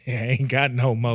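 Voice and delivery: low retold punchline; lightly hammed-up quote, pitched low; embellished imitation. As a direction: repeat his line with a little sauce. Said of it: "I put the little sauce on it".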